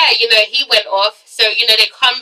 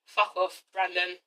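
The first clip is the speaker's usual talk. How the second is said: much softer, under the breath; muttered aside; 6 semitones lower, 15 dB quieter, slower than usual. Retold or imitated. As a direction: mutter it dismissively to yourself. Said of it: "I was just like".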